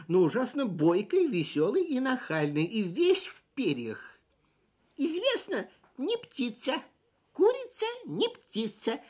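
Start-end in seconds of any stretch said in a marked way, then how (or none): 5.0-9.0 high, cheeky, chirpy sparrow voice; cheerful sparrow. A narrator's reading. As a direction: tease like a cocky sparrow. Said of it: "весело сообщил воробей".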